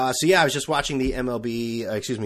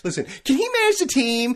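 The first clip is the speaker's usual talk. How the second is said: mock defence; 6 dB louder than usual.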